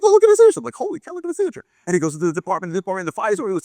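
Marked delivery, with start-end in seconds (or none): 0.0-0.6 high, loud burst of awe; excited showing off. Acted. Man shows colleagues the famous signature, wildly excited. 0.8-1.6 high-pitched awe, held back in volume; starstruck amazement. Acted. Colleague sees the famous signature, amazed.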